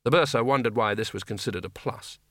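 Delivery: plain reading voice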